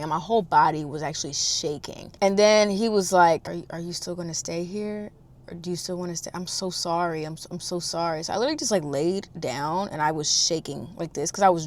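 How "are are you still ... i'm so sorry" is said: very soft, hesitant apology; apologetic. Retold or imitated. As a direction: imitate a man quietly saying sorry. Retells his apology to her.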